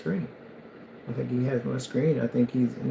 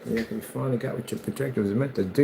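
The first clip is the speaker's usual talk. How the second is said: usual speaking voice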